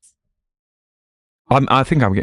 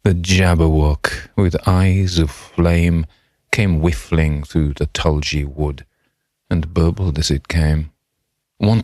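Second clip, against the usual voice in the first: slow, grand elocution reading of the poem; posh poem recital; slower than usual. Acted. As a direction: declaim it like a posh reciter, savouring every word. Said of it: "I can stop talking posh now".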